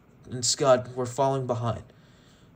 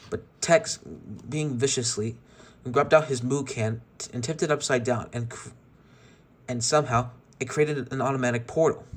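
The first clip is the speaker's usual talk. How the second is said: usual speaking voice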